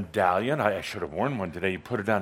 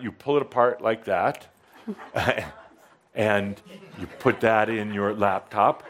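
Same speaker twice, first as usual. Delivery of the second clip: ordinary delivery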